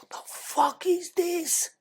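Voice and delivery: high, very slow, stunned disbelief. Retold or imitated. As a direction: let the horror stretch out each word. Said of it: "You're like".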